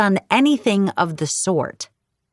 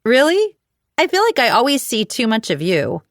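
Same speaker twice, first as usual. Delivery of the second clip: sarcastic; 6 semitones higher, 3 dB louder than usual. A narrator's reading. Sarcastic retort to rival.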